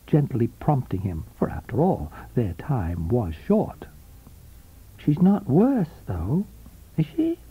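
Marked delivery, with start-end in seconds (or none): 5.0-7.4 light, higher voice with sweet concern; sweetly concerned woman. A narrator's reading. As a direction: ask gently, feigning worry. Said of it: "with sweet concern".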